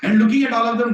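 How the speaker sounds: normal talking voice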